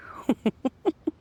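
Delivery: light laugh. Real, not acted. Storyteller finishing the legend, light bashful laugh.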